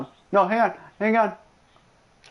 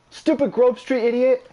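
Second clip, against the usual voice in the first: mock-angry outburst; 7 semitones higher than usual. Real, not acted. Yelling at game character.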